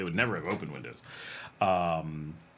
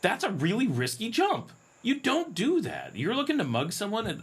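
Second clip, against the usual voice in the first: much higher, incredulous and emphatic; mock disbelief; 12 semitones higher than usual. Real, not acted. Telling viewers mugging him is risky, high mock disbelief. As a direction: act shocked anyone would try it. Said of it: "mock outrage at hypothetical mugger".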